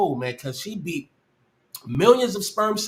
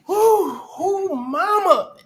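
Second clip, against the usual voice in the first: shaken exhale; 10 semitones higher, 5 dB louder, slower than usual. Real, not acted. Catching breath after a fright.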